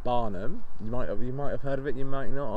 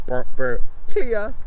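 mock defibrillator; 10 semitones higher, 10 dB louder, much slower than usual. Acting a defibrillator zap.

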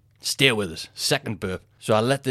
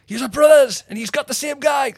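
high, loud jeering impression of the crowd; mock onlooker voice; 7 dB louder than usual. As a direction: squeak it out like a smug bystander. Mocking onlookers' voice.

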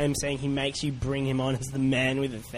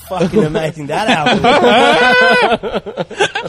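mock troll voice; 4 semitones higher, 10 dB louder than usual. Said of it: "one troll's like".

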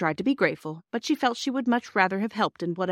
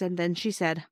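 ordinary delivery

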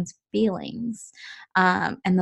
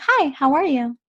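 bright greeting; 7 semitones higher than usual. Retold or imitated. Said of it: "I was just like".

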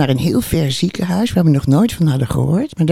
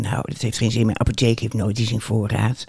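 weary mutter; 6 semitones lower, 7 dB quieter than usual. Retold or imitated. Retold own weary reply.

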